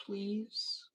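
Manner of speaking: soft high little plea; begging. Acted. Streamer acts pleading girlfriend in imagined spat, soft and high. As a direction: plead sweetly, barely voiced.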